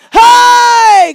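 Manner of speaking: high, loud joyful cry; praise shout. Real, not acted. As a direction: let the praise burst out.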